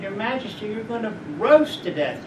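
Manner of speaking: normal talking voice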